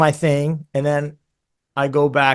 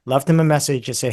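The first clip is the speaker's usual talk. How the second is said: ordinary delivery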